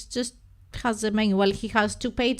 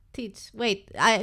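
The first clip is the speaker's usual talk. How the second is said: usual speaking voice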